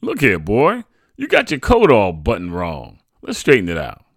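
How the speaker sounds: Dad fussing over the crooked buttons; father voice. A narrator's reading. Father fussing over his son's coat, voiced lightly.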